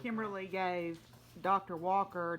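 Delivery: ordinary talk, nothing marked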